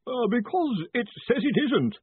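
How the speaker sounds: high, nervous quaver; strained, timid high voice. A narrator's reading. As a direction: quaver the answer to an intimidating boss. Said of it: "Collarbone quavered".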